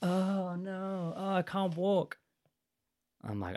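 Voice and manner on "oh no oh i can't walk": fake-drunk girl. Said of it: "she's like".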